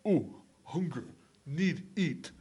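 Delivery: grunted caveman words, one at a time; mock primitive grunting. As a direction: play a simple caveman. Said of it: "cavemen kinda had it easier".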